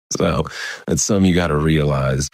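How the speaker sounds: plain reading voice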